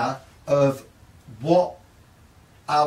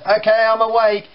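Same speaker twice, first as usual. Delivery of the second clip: put-on sleepy teen; 7 semitones higher, 6 dB louder than usual. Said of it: "still sleepily".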